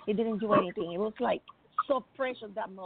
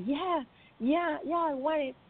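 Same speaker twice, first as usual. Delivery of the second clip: higher-pitched imitation of an emotional yes; retold eager acceptance; 6 semitones higher than usual. Retelling the crying man accepting the gift, eager. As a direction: lift the voice, imitating his eager agreement.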